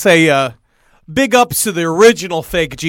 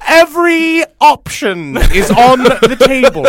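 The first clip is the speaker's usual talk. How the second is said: dark lord; 5 semitones higher, 4 dB louder, slower than usual. Acted. Rael voice continues.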